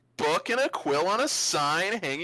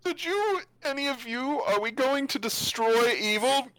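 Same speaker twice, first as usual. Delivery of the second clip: higher-pitched talking sword voice; 7 semitones higher than usual. Acted. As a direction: ask eagerly as the sword. Game master voices the talking sword.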